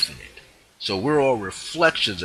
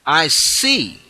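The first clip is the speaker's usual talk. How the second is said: higher, louder authoritative proclamation; decree; 6 semitones higher, 6 dB louder than usual. Shown as a demonstration. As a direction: proclaim it with no doubt. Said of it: "So now you decree".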